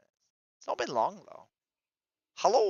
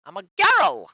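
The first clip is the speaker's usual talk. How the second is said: loud, high-pitched protest; emphatic shout; 13 semitones higher, 15 dB louder than usual. Real, not acted. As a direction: shout it to correct them. Correcting a stranger calling her guy.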